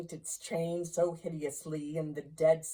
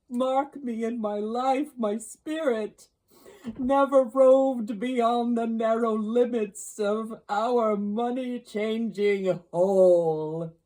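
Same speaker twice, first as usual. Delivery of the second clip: anguished ghost; 5 semitones higher, 7 dB louder than usual. Acted. Ghost's speech in narrated story.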